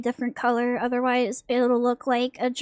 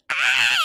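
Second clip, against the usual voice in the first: sharp, much lower, louder scream; baby scream; 12 semitones lower, 4 dB louder than usual. Pretend. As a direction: let out a sudden baby shriek. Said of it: "She goes".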